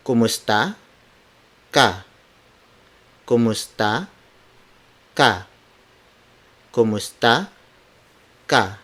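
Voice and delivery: KUMUSTA, long pause, KA, each word alone. Shown as a demonstration. Teacher breaking the greeting down for learners, word by word. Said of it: "Word for word".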